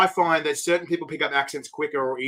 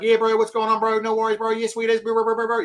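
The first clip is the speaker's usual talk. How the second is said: mock Kiwi accent; 6 semitones higher than usual. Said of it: "I was like".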